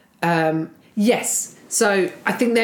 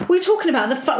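much higher, mock offended; indignant outburst; 8 semitones higher, much faster than usual. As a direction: imitate his instant rage. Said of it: "narcissistic rage".

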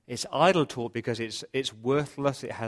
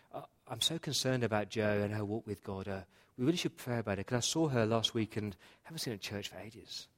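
mock prayer request; 2 semitones lower, 6 dB quieter than usual. Churchgoer disguising gossip as prayer, softly concerned. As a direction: confide gossip softly as pious worry. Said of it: "prayer requests".

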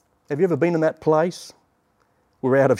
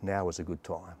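soft whisper; 6 semitones lower, 12 dB quieter than usual. Pretend. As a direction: lower and much softer, a gentle hush. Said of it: "the Lord whispers back".

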